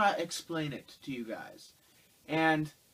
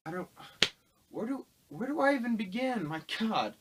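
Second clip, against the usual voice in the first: high, exasperated where do I begin; incredulous, voice jumping up; 8 semitones higher than usual. Real, not acted. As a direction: throw up your hands in disbelief. Overwhelmed reviewer.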